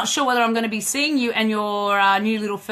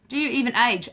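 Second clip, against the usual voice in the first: usual speaking voice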